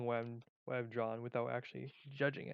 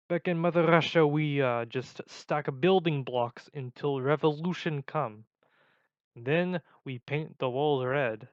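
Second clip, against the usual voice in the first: loud, slow mock Eastern European accent; 7 dB louder, slower than usual. Vlogger telling of revolution in Mother Russia, Eastern European accent. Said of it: "like, Czechoslovakian".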